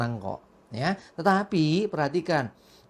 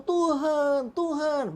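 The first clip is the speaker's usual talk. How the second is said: higher, mock begging "Tuhan, Tuhan"; mock pleading cry; 8 semitones higher, much slower than usual. Pastor imitating churchgoers begging God, higher mock plea. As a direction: imitate people calling on God. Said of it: "Orang sering berseru".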